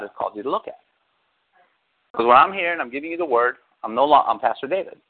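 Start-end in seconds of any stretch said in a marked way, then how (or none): none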